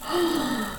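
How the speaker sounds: soft gasp of pretend dismay; worried mock gasp. Teacher hearing a friend is missing, soft mock gasp. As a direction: gasp softly for the children.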